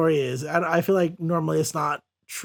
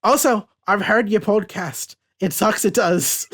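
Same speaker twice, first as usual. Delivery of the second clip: put-on defiant prisoner in a comic Irish accent; 3 semitones higher than usual. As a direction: put on a hammed-up Irish brogue. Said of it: "You gotta do that accent".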